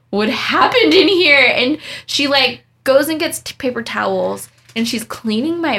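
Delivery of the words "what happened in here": louder, higher retold shocked question; mock an alarmed coworker asking; aghast question.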